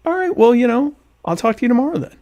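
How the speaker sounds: high-pitched, easygoing acted reply; agreeable reply. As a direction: let them go in a high, innocent voice. Acts out his agreeable reply.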